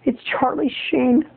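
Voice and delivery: high, slow mock dismay; imagined shocked listener. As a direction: plead in a high, drawn-out voice. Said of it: "I know what you're saying to yourself".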